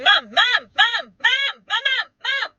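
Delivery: high, insistent repeated calls; imitated nagging child. Five-year-old calling mom at a busy intersection, high mock nagging.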